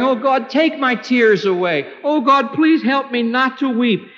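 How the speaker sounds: high, urgent plea to God; acted desperate prayer; pleading prayer. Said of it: "I would be praying".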